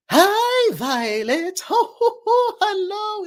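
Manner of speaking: very high, lilting character voice; high and drawn out with a fluty chuckle; Silver. Shown as a demonstration. Granting a fan's request from chat, high character voice. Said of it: "in Silver's voice".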